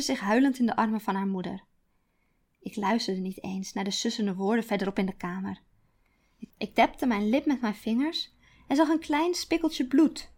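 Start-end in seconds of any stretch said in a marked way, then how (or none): none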